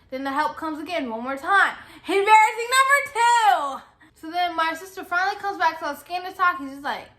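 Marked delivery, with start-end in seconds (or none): 2.1-3.8 high, loud sing-out of a tally; loud, high mock fanfare; dramatic announcement. Mock-announcing her second embarrassment.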